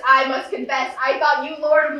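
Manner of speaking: ordinary talk, nothing marked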